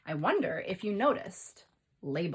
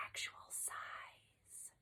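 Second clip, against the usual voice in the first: whisper; 19 dB quieter, much slower than usual. Shown as a demonstration. Whispered caption in read-aloud.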